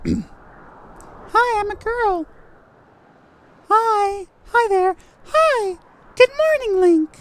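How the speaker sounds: put-on very high falsetto girl voice; young girl. Streamer trying out Zelda's greeting, high young girl voice. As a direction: squeak up into a girlish falsetto. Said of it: "Young girl. Young blonde girl".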